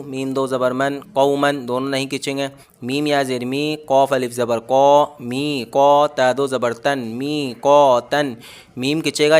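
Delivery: ordinary talk, nothing marked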